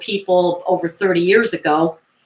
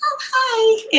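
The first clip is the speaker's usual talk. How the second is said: high small voice; gently voiced little boy; child's greeting. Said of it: "He's like".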